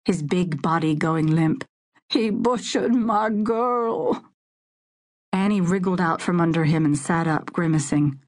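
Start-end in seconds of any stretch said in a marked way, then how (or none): 2.1-4.2 sobbing. A narrator's reading. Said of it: "Hunter Davidson sobbed".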